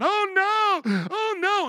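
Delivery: high, loud mock wail of despair; wailing world. Preacher acting the doomed crowd at the Lamb's Supper, wailing. World shouting while judged.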